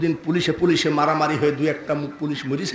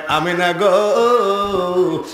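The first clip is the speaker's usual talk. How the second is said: long drawn-out, lilting call; 2 semitones higher, much slower than usual. Retold or imitated. Priest addresses Amina, calling with a drawn-out lilt. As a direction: stretch the name out slowly as you call. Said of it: "পাদরি ডাক দিয়ে কয়".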